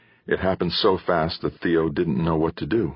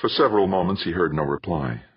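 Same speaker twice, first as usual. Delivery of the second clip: usual speaking voice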